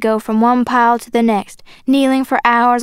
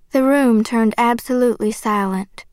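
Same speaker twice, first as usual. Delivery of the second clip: ordinary delivery, nothing marked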